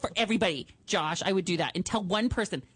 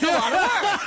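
high, loud, laughing mimicry; mocking high voice; 10 semitones higher, 8 dB louder than usual. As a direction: throw his line back squeaky and laughing. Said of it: "I'm like".

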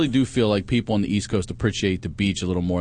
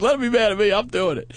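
high-pitched, insistent retort; excited mock defiance; retold defiance; 10 semitones higher than usual. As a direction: brush off the objection, acting it out.

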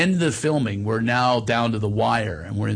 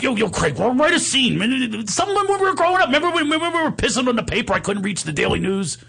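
high-pitched, stammering, eager pitchman; Steiny; 11 semitones higher than usual. Retold or imitated. Amateur actor begs director for a new scene, excited impression. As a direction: tumble over the words in a high comic voice. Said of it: "He goes".

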